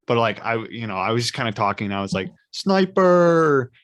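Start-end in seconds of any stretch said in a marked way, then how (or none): 2.5-3.7 blurted out. Retold or imitated. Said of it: "I didn't yell it".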